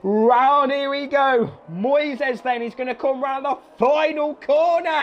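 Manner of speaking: high, drawn-out race-climax call; ecstatic play-by-play. Real, not acted. Commentator as Moises comes round the final corner, ecstatic. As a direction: build to a cry on the last words.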